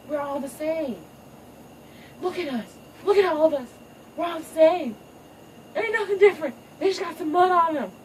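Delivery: rally everyone to unity; high hammed-up peacemaker speech; pleading daughter. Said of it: "Kiara's like".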